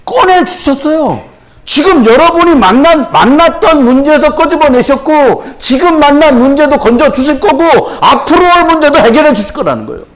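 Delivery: high, ringing sermon delivery; full-force preaching. Real, not acted. Preacher assuring the congregation of rescue from every problem, impassioned preaching. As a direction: drive each promise home, high and insistent.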